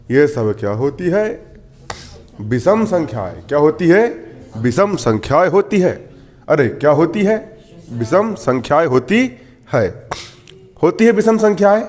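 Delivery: ordinary talk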